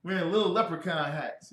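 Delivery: ordinary talk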